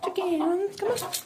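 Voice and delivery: high, sing-song call to the hens. Real, not acted. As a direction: coax the hens over in a high voice. Calling the chickens over.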